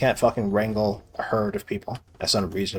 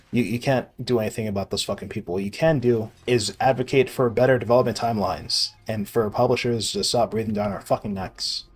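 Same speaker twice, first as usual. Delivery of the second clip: ordinary talk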